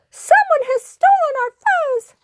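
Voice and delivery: very high, squeaky outcry; alarmed cry. Acted. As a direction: raise the alarm in a tiny voice. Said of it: "cried Pip Squeak".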